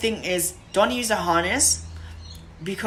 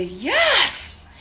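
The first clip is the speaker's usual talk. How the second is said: yes, much higher and bright with praise; high-pitched yes; 10 semitones higher than usual. Shown as a demonstration. Trainer rewarding a puppy, very high-pitched yes. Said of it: "in a high-pitched voice".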